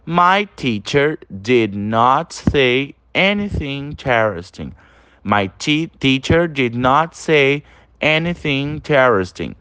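slow, even English sentence; slow model reading. Shown as a demonstration. Teacher reading the third example to students, slowly. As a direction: read it slowly for repetition. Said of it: "E o terceiro exemplo".

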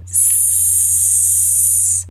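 a long voiceless hiss on S; hissing s sound. Shown as a demonstration. Teacher demonstrating the S sound, a long soft hiss. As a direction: hold a quiet hiss, tongue behind the teeth. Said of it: "repete comigo".

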